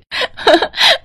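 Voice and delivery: high-pitched gleeful laugh; delighted laugh. Acted. Wife laughs over the new money, delighted.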